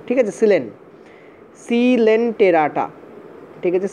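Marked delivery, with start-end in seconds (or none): 1.7-2.8 সিলেন্টেরাটা spelled out syllable by syllable, very slowly; teacher modelling সিলেন্টেরাটা slowly for students; slow careful pronunciation. Shown as a demonstration. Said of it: "এইভাবে উচ্চারণ করা হয়".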